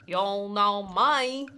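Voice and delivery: slow Southern drawl; southern accent. Pretend. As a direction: stretch it out like a Texan. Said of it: "my southern accent's the strongest".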